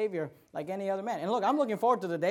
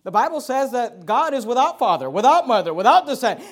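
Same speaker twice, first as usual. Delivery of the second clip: raised, loud, emphatic declaration; full-force preaching; 3 semitones higher, 8 dB louder than usual. Real, not acted. Preacher declaring who Jesus is, loud full-force preaching. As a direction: hammer each phrase out to the room.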